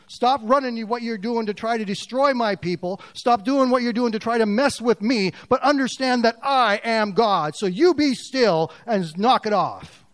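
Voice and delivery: a hint of God's stern command; stern rebuke. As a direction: scold the enemies firmly.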